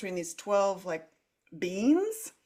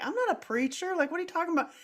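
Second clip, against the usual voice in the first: much higher disbelieving reply; higher incredulous talk to the beings; incredulous protest; 9 semitones higher, faster than usual. Retold or imitated. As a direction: push back in squeaky disbelief. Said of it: "And I'm like".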